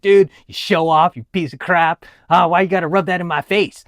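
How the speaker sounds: hammed-up resentful complaint; mock hater. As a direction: throw it out as an accusing jab. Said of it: "I wasn't dropping hater comments like".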